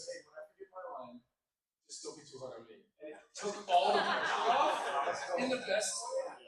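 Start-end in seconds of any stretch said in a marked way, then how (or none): 0.0-2.8 softer, low-key reassurance; retold reassurance. Co-star reassuring the actor after a flub, soft reassurance. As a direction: take the pressure off with a quiet word. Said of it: "And he goes".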